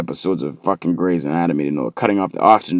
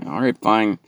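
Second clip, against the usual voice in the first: usual speaking voice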